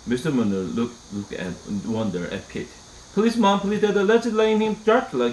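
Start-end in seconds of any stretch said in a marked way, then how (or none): 3.1-5.3 put-on high, begging child pleading with his parents; pleading cry. A narrator's reading. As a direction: beg Mom and Dad with everything you've got. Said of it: "cried Toby".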